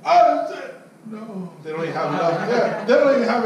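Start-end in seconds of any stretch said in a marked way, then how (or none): 0.0-1.5 mock feeble old lady raising her voice, slow on the repeat; imitating a tired customer trying to shout, drawn out; old lady straining to raise her voice at the salesman, mocking; weak raised voice. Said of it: "they try to raise their voice".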